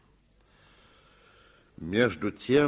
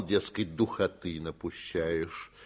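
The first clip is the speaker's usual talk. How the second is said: usual speaking voice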